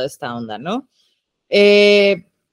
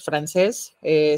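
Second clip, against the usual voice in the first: usual speaking voice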